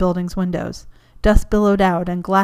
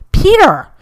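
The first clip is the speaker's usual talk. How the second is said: loud, much higher burst of relief; relieved cry; 12 semitones higher, 11 dB louder than usual. Acted. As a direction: shout his name in sudden relief. Sees caller ID after explosion.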